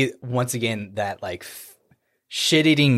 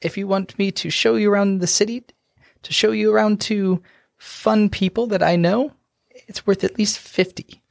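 sly halfling voice; 10 semitones higher than usual. Acted. Halfling naming his price to the dwarf, high and sly.

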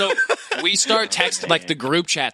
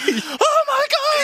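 very high, loud, drawn-out wail; hammed-up panicked squeal through laughter; mock panic; 5 dB louder, much slower than usual. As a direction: stretch it into a high, laughing cry. Acts own panicked reaction.

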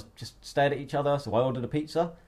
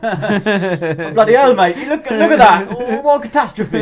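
loud, high laughing Aussie bloke; aussie tradesman; 11 semitones higher, 15 dB louder, slower than usual. Pretend. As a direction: shout it like a shocked Aussie tradesman. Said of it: "womp, gone".

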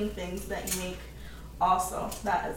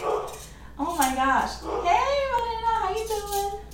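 high, excited pet greeting; excited dog voice; 12 semitones higher, slower than usual. Retold or imitated. The dog greeting Marina, high and excited. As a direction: squeal it like the dog is thrilled to see her. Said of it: "my dog is barking 'cause he's like".

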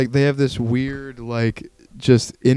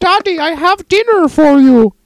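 high, loud caricature daughter calling Daddy; mock sinister daughter; 6 dB louder than usual. Daughter calls her father to dinner, loud high caricature. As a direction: sing it out high to Daddy, put-on. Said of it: "She's like".

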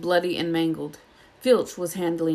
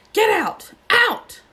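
much higher and louder, rising to a screech; furious yell; 8 dB louder, much slower than usual. Acted. Snape drives Harry out of the staff room, screaming. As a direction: throw the boy out of the room.

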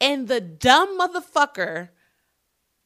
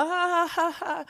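a forced, hammed-up ha ha ha pitched high; fake laugh; 8 semitones higher than usual. Waving at a stranger's camera, mock fake laugh. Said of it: "fake as fuck".

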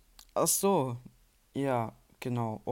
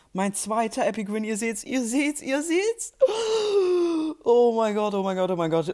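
excited; 8 semitones higher, 5 dB louder than usual. Real, not acted. Celebrating second win.

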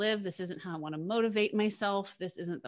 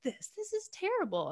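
much higher and softer, whiny dismay; higher, softer mock dismay at the scale; retold dismay; 12 semitones higher, 7 dB quieter than usual. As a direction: whine it quietly to yourself. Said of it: "and I was just like".

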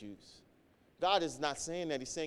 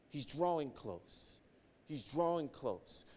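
softly; 3 semitones lower, 9 dB quieter than usual. Acted. Preacher softens to comfort.

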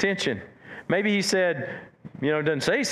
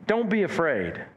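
gentle reassurance; 2 semitones higher than usual. Shown as a demonstration. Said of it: "I don't know what the tone was".

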